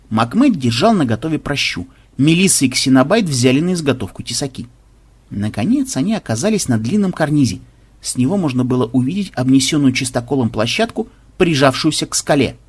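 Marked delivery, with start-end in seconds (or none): none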